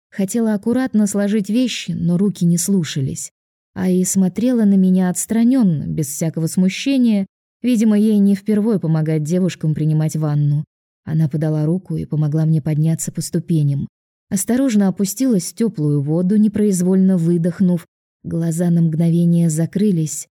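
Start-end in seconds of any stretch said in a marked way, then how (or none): none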